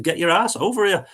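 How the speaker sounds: high, punchy command; demanding. Retold or imitated. Telling the host to come over, a high fed-up demand. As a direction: order him over, fed up.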